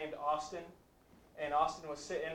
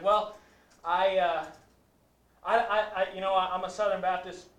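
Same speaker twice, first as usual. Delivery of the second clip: higher and louder, stammering; flustered excuse; 3 semitones higher, 9 dB louder than usual. Retold or imitated. Student caught by the question, stammering higher and louder. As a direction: stumble over the words, caught out. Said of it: "He's like".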